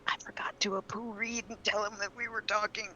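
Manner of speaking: breathy aside under the breath; whispering. Real, not acted. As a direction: whisper it to yourself.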